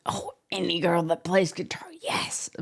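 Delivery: breathy admiring whisper; whispered awe. Retold or imitated. Quoting own admiring thought.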